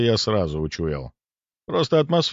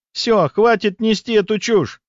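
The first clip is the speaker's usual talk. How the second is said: outburst; 12 semitones higher, 4 dB louder, slower than usual. A narrator's reading. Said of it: "вновь вскочила со своего места Настя".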